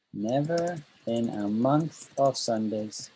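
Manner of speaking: teacher modelling the phrase slowly; slow, deliberate idiom. Shown as a demonstration. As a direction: say it slowly so they catch it. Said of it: "That just means never".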